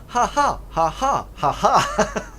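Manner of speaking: high, loud mock "ha ha"; badly faked, spelt-out laugh; joining the laughter. Newcomer blending in with laughing ruffians, high fake laugh. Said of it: "join in the laughter".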